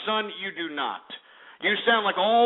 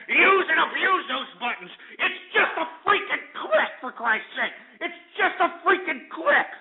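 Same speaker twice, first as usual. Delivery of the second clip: high-pitched, worked-up exhortation; hyper; 8 semitones higher than usual. Real, not acted. Host begging listeners to click share buttons, hyper outburst. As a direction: plead at the top of your range, exasperated. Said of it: "I'm just getting a little hyper".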